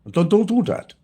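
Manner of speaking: high-pitched mock-German command; lightly put-on German doctor, repeating himself; German accent. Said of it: "Gotta put a German accent".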